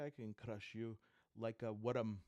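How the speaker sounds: ordinary delivery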